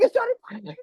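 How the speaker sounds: very high, loud, insistent squeal; exasperated fan. Retold or imitated. Husband insisting to his wife, high and exasperated. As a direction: stress the name as if it's obvious.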